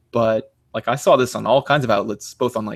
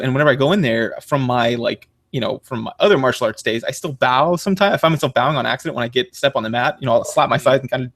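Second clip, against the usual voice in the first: ordinary talk, nothing marked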